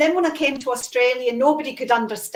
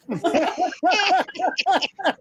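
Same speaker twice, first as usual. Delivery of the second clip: higher-pitched burst of laughter; laughing; 5 semitones higher than usual. Real, not acted. Woman laughing at her own elocution poem, high giggling laugh.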